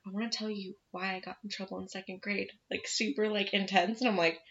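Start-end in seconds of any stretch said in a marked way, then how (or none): 0.1-2.5 put-on quiet, insistent child's voice, much softer than usual; hushed insistent child; hushed insistence. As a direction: insist in a hush like a kid in a cinema.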